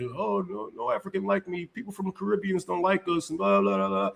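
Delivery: mocking quote. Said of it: "fall for that bullshit".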